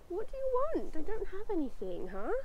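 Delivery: high, playful voice to the horse; teasing pet voice. Real, not acted. Teasing horse hoping for food.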